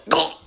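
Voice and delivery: sharp strangled gah as the neck hits; a winded, choked gah. Retold or imitated. Kid slamming his neck on the trampoline bar, strangled gah. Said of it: "that's the noise I made".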